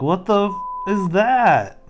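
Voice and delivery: mock-shocked question; curious startled character. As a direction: ask it like a baffled cartoon face. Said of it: "he's just curious".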